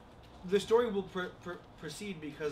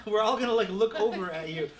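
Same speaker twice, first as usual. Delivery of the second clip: talking through loud laughter; laughing; 5 semitones higher, 11 dB louder than usual. Real, not acted. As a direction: laugh while saying it. Laughing at Mariah crawling in.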